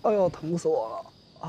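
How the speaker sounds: slow, high, hammed-up wail of pain; milk the pain for sympathy; exaggerated pain. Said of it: "别装了".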